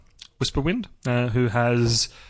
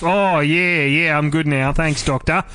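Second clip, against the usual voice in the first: a hammed-up broad Australian accent, pitched up. Joking about the BBC, a man mimics a broad Australian accent. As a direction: play the Aussie bloke calling the doctor for help in an Australian accent. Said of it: "why is the B B C got Australian accents".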